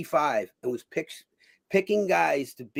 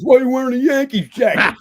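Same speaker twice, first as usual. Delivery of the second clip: mock questioning crowd; 10 semitones higher, 9 dB louder than usual. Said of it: "everybody goes".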